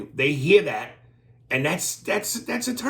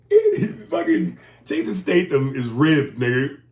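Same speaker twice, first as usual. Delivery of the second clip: loud delighted outburst; excited yell; 3 semitones higher, 6 dB louder than usual. Real, not acted. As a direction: blurt it out, thrilled. Excited outburst about Statham.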